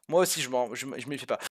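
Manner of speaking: ordinary delivery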